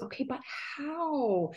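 soft, whiny, slow complaint; whiny inner voice. Retold or imitated. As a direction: whine it quietly to yourself. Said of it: "this whiny voice in my brain going".

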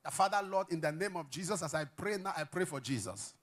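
low, soft praying voice; prayer. Shown as a demonstration. Demonstrating a prayer.

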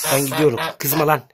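loud rushed goodbye as the goose lunges; startled shout. Real, not acted. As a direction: shout it while backing away. Goose attacks as he leaves. (kaz saldırınca bağırarak veda)

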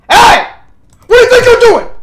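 loud, high-pitched yelling; outraged shout. Real, not acted. Streamer catching a game character misbehaving, outraged high shout. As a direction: yell at them to stop.

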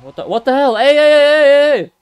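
high panicked shouting; real frantic yell. Real, not acted. Monster attacks in game.

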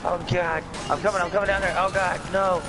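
yelling in panic; panicked shouting. Real, not acted. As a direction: shout it, scared and frantic. Panicked shouting during game attack.

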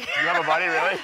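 high, loud disbelief; surprised. Real, not acted. Surprised at the name Buddy.

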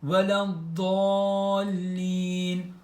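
"veleddallin" stretched very slowly with the long d sound; Quran-style "veleddallin" slowly drawn out; drawn-out Quranic word. Shown as a demonstration. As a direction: hold "veleddallin" as in recitation. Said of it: "Fatiha suresinde de geçtiği gibi".

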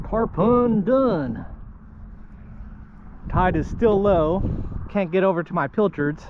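ordinary talk

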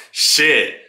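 ordinary delivery

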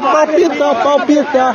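giddy high repeat; excited sing-song. Real, not acted. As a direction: lift it up high like your heart is racing. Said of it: "meu coração palpita".

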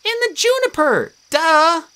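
high, squeaky, teasing duh; squeaky nymph. A narrator's reading. Tree nymph teasing the demigods, high and squeaky. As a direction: roll your eyes at them.

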